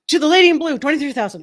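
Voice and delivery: loud, high auctioneer cry; auctioneer call. Retold or imitated. Auctioneer awarding the bid to the lady in blue, loud high call.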